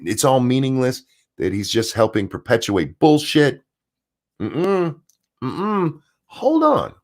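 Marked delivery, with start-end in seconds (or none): 4.4-6.9 mock objection. Real, not acted. Sudden objection, squeaky voice.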